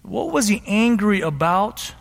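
normal talking voice

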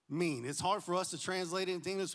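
usual speaking voice

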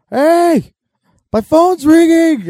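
high, loud, drawn-out call; dad yelling. Retold or imitated. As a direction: holler it to the whole house. Acting out dad when phone rings.

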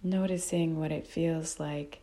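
calm, unhurried meditation guide with long pauses; guided meditation. Real, not acted. Teacher guiding listeners to notice breath and body, calm meditation voice. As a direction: guide them gently, phrase by phrase, leaving long silences.

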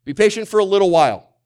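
high, fast and loud, poking fun; mocking the watered-down verse, high and loud; mock twisted reading. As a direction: rush it like a cheap excuse. Preacher mocks a shortened reading.

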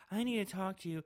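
a hint of a worried teen; mock student voice. Podcaster quoting the pregnant girl, lightly voiced. Student quoted, higher and far.

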